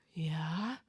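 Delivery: a soft, breath-only yeah; sheepish whispered admission. Retold or imitated. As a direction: quietly whisper yes. Sheepish quiet answer to guy.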